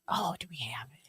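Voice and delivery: whispered aside to herself; whispering. Real, not acted. Aside while looking for pictures.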